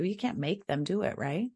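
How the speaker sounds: ordinary delivery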